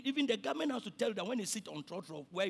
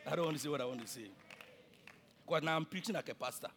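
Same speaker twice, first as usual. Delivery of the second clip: ordinary talk, nothing marked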